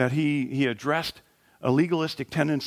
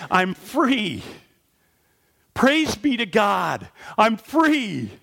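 joyful crying out; 6 semitones higher, much slower than usual. Acted. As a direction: shout it out, freed at last. Woman healed, crying out to God.

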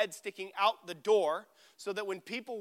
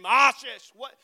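loud, higher mock scream; retold scream; 4 semitones higher, 11 dB louder, slower than usual. Student who overslept the test calling his friend, mock scream. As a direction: yell the friend's name in mock outrage. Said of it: "I start screaming Ashish's name".

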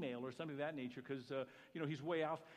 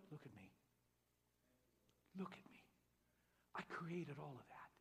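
soft call; 8 dB quieter than usual. Acted. God softly speaking through clouds.

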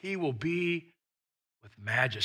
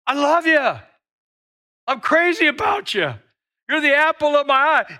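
higher and louder, a mock-romantic outburst of devotion; gushing love declaration; 8 semitones higher, 9 dB louder than usual. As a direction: gush it like a smitten lover. God declaring love to listeners.

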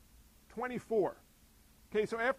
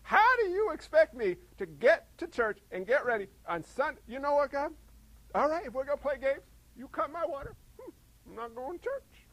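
higher, loud mock rant at God; hammed-up griping; 6 semitones higher, 10 dB louder than usual. Pastor acting out griping at God over cut-off water, hammed-up rant. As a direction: gripe like a cranky churchgoer. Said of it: "I'm gonna grumble a little bit".

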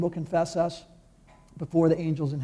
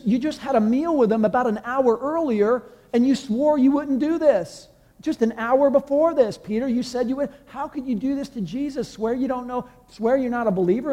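preacher rebuking Peter, pitched up; indignant rebuke; 6 semitones higher than usual. Retold or imitated. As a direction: scold Peter, higher, in disbelief. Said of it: "I look at this picture and I'm like".

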